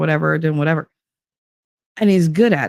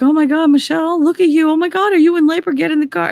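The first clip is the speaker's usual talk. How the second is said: much higher, fast alarmed gush; 12 semitones higher, faster than usual. Retold or imitated. Ex sees her in labor on the street, much higher, fast and alarmed. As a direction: rush the words in a panic.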